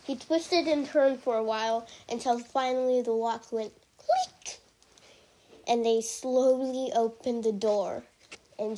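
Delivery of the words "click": click sound. A narrator's reading. Lock opening sound effect.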